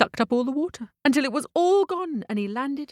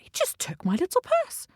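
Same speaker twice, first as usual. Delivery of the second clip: high-pitched, put-out disbelief; disbelieving cockerel; 11 semitones higher than usual. Acted. Cockerel watching the carriage leave with his purse, disbelieving. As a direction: voice a cockerel who can't believe it, pitched up. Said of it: "The cockerel couldn't believe it".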